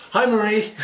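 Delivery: high, loud hello; acted over-eager greeting. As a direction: call out brightly to someone walking in. Calls greeting to ex in store.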